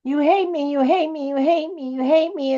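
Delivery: mock the actress whining; whiny mock.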